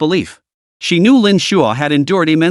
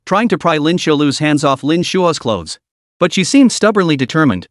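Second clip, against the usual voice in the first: ordinary delivery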